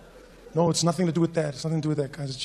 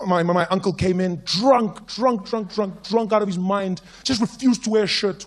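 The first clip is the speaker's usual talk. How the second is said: ordinary talk, nothing marked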